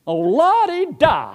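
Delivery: high, slow, sing-song mockery; mocking la-di-da. Preacher mocking a teaching, high and sing-song. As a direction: scoff at the idea in a high drawn-out lilt.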